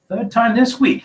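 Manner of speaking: loud, high-pitched angry roar. A narrator's reading. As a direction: roar it at the table. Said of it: "he roared across the table".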